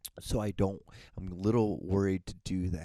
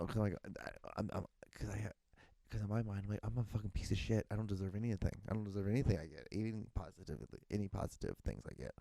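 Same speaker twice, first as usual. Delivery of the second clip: softer, sinking into muttered self-blame; quiet self-loathing; 7 dB quieter, faster than usual. Real, not acted. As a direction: let it drop away as you run yourself down.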